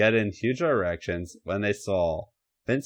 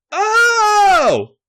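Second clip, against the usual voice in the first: high, loud cry of pleasure in a far-off voice; ecstatic cry out; 13 dB louder than usual. A narrator's reading. Stephanie crying out to the Undertaker in high loud pleasure. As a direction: let out a high, loud, breathless oh. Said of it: "she called out".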